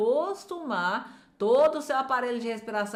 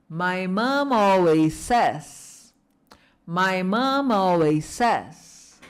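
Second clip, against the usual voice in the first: joined up but very slow and clear; slow whole phrase; 3 semitones lower, 3 dB louder, much slower than usual. Shown as a demonstration. Teacher joining the phrase for students to echo. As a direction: say it all together, slowly and loudly. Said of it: "Em voz alta?".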